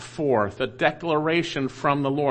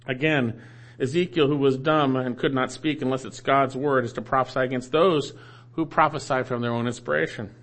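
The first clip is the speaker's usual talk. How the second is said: usual speaking voice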